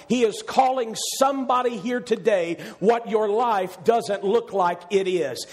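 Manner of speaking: usual speaking voice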